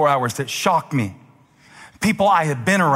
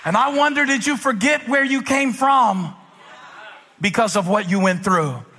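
raised, emphatic pulpit cry; fervent preaching; 7 semitones higher than usual. Real, not acted. Preacher reminding believers where they came from, fervent preaching. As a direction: call it out to the congregation in praise.

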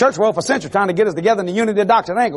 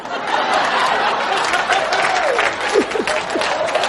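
high cackle; 12 semitones higher than usual. Real, not acted. Preacher cackling after his punchline.

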